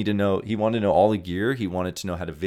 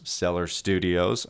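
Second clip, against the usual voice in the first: ordinary talk, nothing marked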